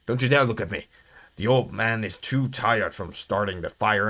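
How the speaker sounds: low, soft game-character voice; grumbling old watchman. Acted.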